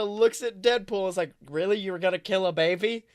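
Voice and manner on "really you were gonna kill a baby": ordinary talk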